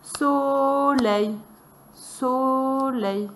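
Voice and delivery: "Soleil" chanted high, first syllable held long; scanned syllables. Shown as a demonstration. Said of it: "on va scander les syllabes".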